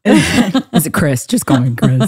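real raspy low voice from laryngitis; hoarse low voice. Real, not acted. Host with laryngitis joking about her low voice, hoarse. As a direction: push through a lost voice. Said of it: "it's so low".

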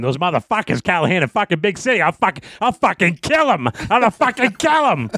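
re-enacted rage of an angry friend; imitated hot-headed fury. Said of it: "He was fucking hot".

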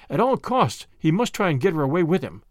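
ordinary delivery, nothing marked

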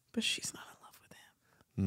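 unvoiced gossip whisper. Real, not acted. Friend whispering gossip about a reality star. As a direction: lean in and whisper it.

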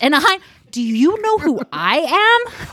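high, slow, haughty voice; snooty empress. Pretend. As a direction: play the offended royal.

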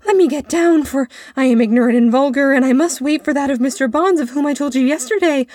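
high, loud, hurried pleading; flustered boy crying out; urgent cry. A narrator's reading. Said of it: "he cried".

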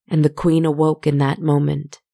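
ordinary delivery

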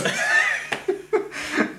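unvoiced high cackle; breathy high laugh. Real, not acted. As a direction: let out a silent laugh. Laughing at hacker meme.

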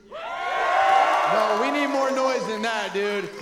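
loud, high crowd hype-up; hype announcer. Pretend. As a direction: whip the crowd up shouting. Hypes up crowd for guest.

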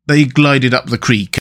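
plain reading voice

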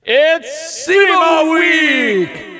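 stretched shout through a tube; tube echo shout. Shown as a demonstration. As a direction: yell it down a hollow tube. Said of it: "It doesn't sound big enough".

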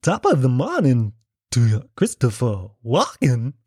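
awful actor impression with lurching pitch; badly faked Irish greeting, oddly stressed and halting; Christopher Walken. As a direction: drag out the words in a terrible Walken voice. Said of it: "as bad a Christopher Walken impression".

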